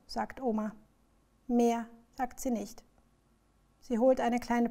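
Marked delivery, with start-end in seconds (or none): none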